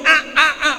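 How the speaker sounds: mock straining grunts; short pushing grunts, ah ah ah; imitated baby straining. Father mimics his straining baby son, mock grunts.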